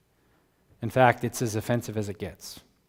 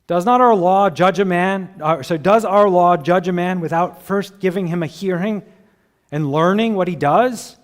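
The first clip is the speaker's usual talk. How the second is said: pointed challenge; 5 semitones higher, 8 dB louder than usual. Acted. Nicodemus confronts the Pharisees.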